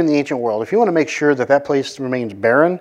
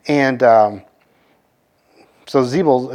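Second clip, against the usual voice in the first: normal talking voice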